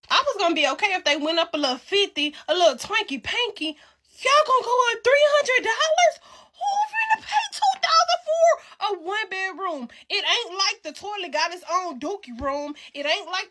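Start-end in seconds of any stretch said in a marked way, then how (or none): 3.7-9.9 outraged shout. Real, not acted. Ranting at the rent jump, outraged high yell. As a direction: shout it at the landlord in disbelief.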